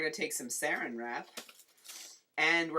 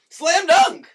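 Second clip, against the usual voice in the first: big triumphant yell, way up high and loud; celebratory slam dunk shout; 18 dB louder than usual. Real, not acted. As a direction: whoop it like a sports win. Cheering a cracked egg.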